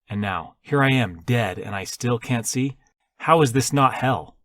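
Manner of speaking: loud, high-pitched indignant rant; heated, forceful protest. A narrator's reading. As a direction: rail bitterly against the injustice, loudly. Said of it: "He raised his voice".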